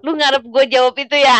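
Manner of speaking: fast, loud teasing shout. Real, not acted. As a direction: fire back a teasing shout. Teasing comeback after joke.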